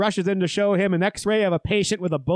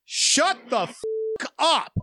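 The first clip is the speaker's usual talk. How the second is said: higher, drawn-out mock yell; retold angry yell; 5 semitones higher, slower than usual. Imitating the guy behind him at the heckler, mock yell. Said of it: "the guy behind me yells out".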